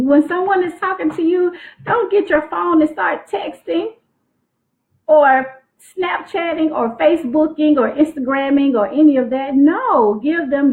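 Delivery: high-pitched lecturing warning; mock scolding. Shown as a demonstration. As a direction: scold them like a strict aunt. Scolding rule, raised pitch.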